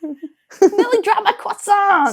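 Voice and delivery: slow words through laughter; high and loud, giggling; laughing slowly. Real, not acted. Laughing reply.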